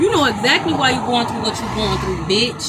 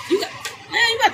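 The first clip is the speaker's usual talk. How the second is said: high laughing exclamation; 10 semitones higher, 3 dB quieter, much faster than usual. Real, not acted. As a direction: burst out laughing. Laughing excited cry.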